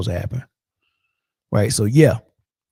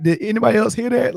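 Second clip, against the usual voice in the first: high and quick, mock alarmed; pitched-up spooked question; 9 semitones higher, faster than usual. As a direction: ask around nervously in a hammed-up way.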